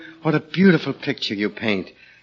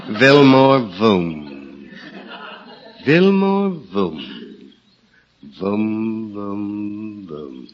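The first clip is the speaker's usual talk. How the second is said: slow booming call that fades away; shouting down a rain barrel; 4 semitones lower, much slower than usual. Pretend. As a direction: echo it down a barrel, dying off. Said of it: "a beautiful name to shout down a rain barrel".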